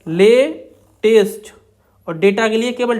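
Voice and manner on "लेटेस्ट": लेटेस्ट stretched very slowly; drawn out while writing. Shown as a demonstration. Teacher writing the outline for students, slowly. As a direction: pull लेटेस्ट out as the stroke is drawn. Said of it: "देखिए कैसे बनाएंगे".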